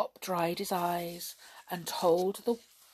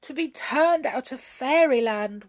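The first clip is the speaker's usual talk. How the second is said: raised, plaintive lament; weeping; 5 semitones higher, 7 dB louder than usual. A narrator's reading. Jump banished from Fairyland, weeping. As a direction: lament it with mild tearfulness. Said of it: "wept Jump".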